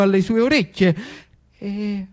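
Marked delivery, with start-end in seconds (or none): none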